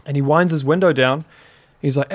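ordinary talk

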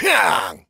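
growling monster roar; dinosaur roar. Acted.

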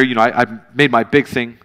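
ordinary delivery

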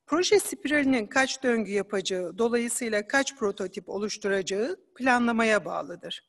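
usual speaking voice

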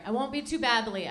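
ordinary delivery

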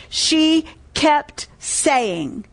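very slow, spaced-out words; drawn-out emphasis. Real, not acted. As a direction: stretch out each word to drive it home. Preacher drives home the key phrase.

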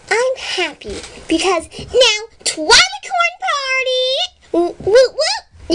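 excited cheering. Acted. Pinkie cheering a party.

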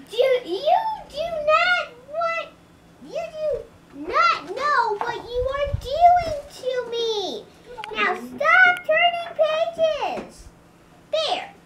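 high, loud, drawn-out scolding; exasperated yelling. Acted. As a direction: plead at the top of your voice. Grover scolds the page turner.

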